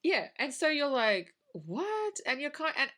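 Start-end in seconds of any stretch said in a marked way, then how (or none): 1.5-2.1 high-pitched incredulous "what?"; mock bafflement. Podcaster retelling a viewer's confusion at the film, baffled. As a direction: act out a viewer's baffled reaction.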